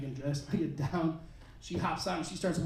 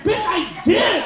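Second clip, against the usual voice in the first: higher, louder, very slow indignant yell; outraged shout; 9 semitones higher, 7 dB louder, much slower than usual. Retold or imitated. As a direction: stretch it out in disbelief.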